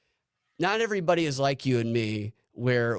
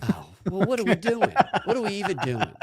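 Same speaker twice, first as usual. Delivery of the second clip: much higher, put-on indignant voice; high mock protest; 10 semitones higher, 3 dB louder than usual. Real, not acted. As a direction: squeak out mock offense. Reacting to sultry voice request.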